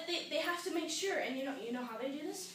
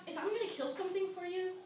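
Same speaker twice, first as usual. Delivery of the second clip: usual speaking voice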